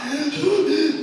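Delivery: sharp loud high gasp; loud dramatic gasp. Retold or imitated. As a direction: gasp as if overcome. Retelling sobbing scene.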